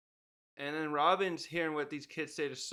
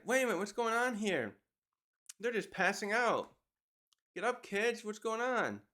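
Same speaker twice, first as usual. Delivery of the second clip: higher-pitched mock bewilderment; retold alarm; 6 semitones higher than usual. Recapper voices Angie as kids pass out, retold alarm. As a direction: act out confused alarm, pitch raised.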